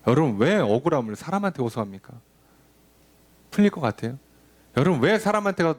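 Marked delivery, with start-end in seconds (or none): none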